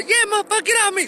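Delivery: shrill high-pitched yell; high yelling woman. Retold or imitated. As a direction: shout it high. Woman voiced in quarrel.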